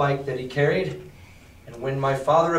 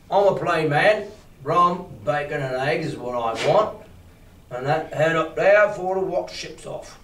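lightly voiced captain. Teacher voicing the captain.

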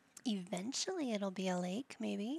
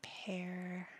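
whisper; 4 dB quieter than usual. Real, not acted. Muttering shopping choices.

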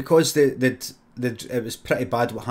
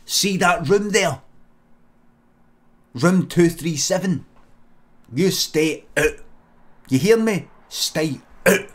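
mock stern warning; 5 semitones higher, 4 dB louder, slower than usual. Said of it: "You say to Wayne".

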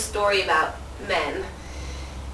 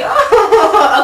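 laughing; 9 semitones higher, 9 dB louder than usual. Acted. Laughing at puppy.

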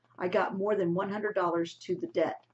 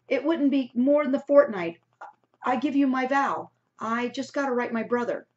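earnest pleading; 6 semitones higher, 6 dB louder than usual. A narrator's reading. Liddy pleading, vowing.

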